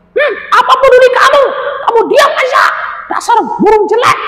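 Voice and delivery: furious squeaky creature yelling; angry threat. Acted. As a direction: threaten the fleeing porcupine. Anjani insults the parrot.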